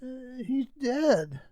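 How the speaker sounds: high, breathless servant voice; frightened butler breaking the news; alarmed servant. Acted. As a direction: blurt out the bad news. Servant reports the death.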